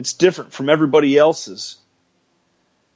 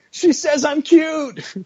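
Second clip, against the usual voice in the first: giddy laughing joy; slower than usual. Acted. Giddy after compliment.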